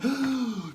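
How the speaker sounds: sharp high gasp; mock shocked gasp. Shocked onlooker, sharp high gasp. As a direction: gasp as if caught off guard. Shocked at someone's towel slipping.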